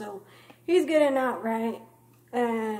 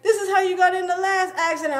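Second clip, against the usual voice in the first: mom scolding; 6 semitones higher, 5 dB louder than usual. Retold or imitated. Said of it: "She's like".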